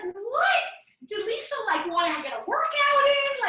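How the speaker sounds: high, loud, oblivious mother-in-law; imitating mother-in-law. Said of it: "And she's like".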